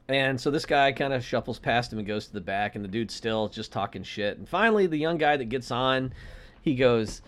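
usual speaking voice

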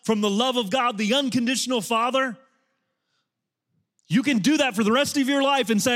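loud, high, driving sermon voice; full-force preaching. Acted. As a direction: preach it hard to the whole room.

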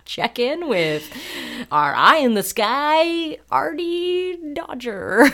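slow, cheesy radio DJ; radio host voice. Pretend. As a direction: ham it up like morning radio. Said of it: "imagine if I had to talk that way".

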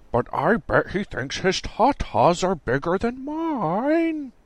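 slow, high, put-on protesting whine; defensive protest. A narrator's reading. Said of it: "Bowman protested, very defensive".

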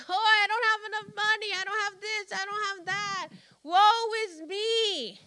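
hammed-up self-pitying victim; mock complaining. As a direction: rattle off the grievances fast, then draw out the last woe. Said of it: "The complaining people".

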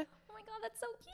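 high, soft squeal of delight. Real, not acted. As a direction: gush over it like something adorable. Delighted by the Twitter handle.

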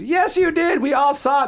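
mock high-pitched insistence; squeaky mocking contradiction; insistent friend imitation. Acts out friend insisting in argument.

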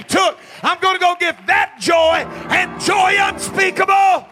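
loud, high, rhythmic shouted preaching; rising call-and-response sermon peak; preaching at full force. Acted. Preaching about joy.

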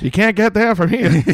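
mock Down East accent, laid on thick; bad Maine accent. Mocking a movie local giving directions, bad Maine accent. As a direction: deliver it like a Maine local joke. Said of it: "what we just did is the accent".

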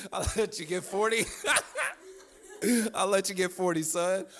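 talking through real laughter; laughing. Real, not acted. Dad offering son a sixty-forty deal, laughing. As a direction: crack up while getting the line out.

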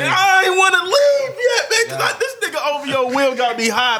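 loud, high, worked-up complaint; high-pitched outburst, words stretched out at the start; heated shouted protest. Real, not acted. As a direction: holler it, fired up and half laughing. Heated protest at having to leave.

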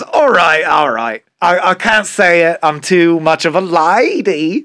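put-on Cockney lady, coyly refusing; Cockney accent. Limerick winner refusing to recite, in a mock Cockney accent. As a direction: play a reluctant Cockney woman, drawing out the end. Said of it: "does it in a Cockney accent".

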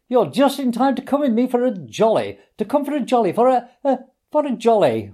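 hearty high voice stumbling over repeats; faltering. A narrator's reading. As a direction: lose your nerve mid-invitation. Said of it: "His hearty accents faltered and fell away".

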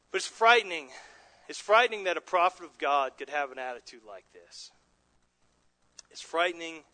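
ordinary talk

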